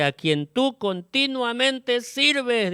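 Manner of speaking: king's high drawn-out call to Daniel; sad calling out. A narrator's reading. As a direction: call down into the pit in grief. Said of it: "llamó a voces a Daniel con voz triste".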